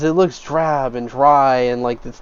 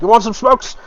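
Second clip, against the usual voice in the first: smoke peddler; 8 semitones higher than usual. Acted. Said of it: "He's like".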